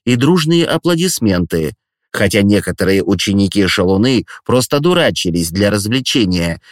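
plain reading voice

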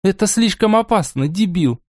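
high, sharp scolding fairy. Acted. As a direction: snap the insult at him. Amelia scolding Borey.

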